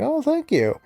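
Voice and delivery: a light mock girly voice of gratitude; sweet thank-you. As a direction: gush a grateful thank-you. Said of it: "she's like".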